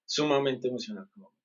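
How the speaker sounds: a quiet afterthought; soft echo. Real, not acted. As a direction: drop the volume and repeat it to yourself. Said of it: "pero mamá es mamá y es sumamente emocional".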